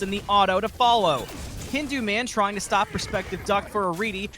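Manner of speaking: play-by-play building to a roar; rising hype, shout the big moments; fighting game announcer. Real, not acted.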